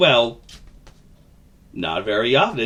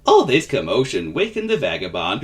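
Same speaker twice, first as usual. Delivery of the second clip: plain reading voice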